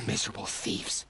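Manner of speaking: whispered bitter mutter. Acted. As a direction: mutter it under your breath. Bitter aside about the thieves.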